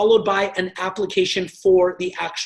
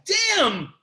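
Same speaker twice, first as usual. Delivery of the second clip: caught out; 8 semitones higher than usual. Acted. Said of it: "some of you guys are like".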